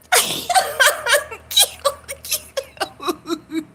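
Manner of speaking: real high, long laugh; hearty laughing fit. Real, not acted. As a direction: laugh hard at your own joke. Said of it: "I was laughing my heart out".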